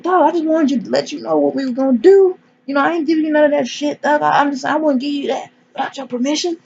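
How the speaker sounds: high-pitched placating boss imitation; mock placating. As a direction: play at talking him down, high-pitched. Boss placates the panicking man.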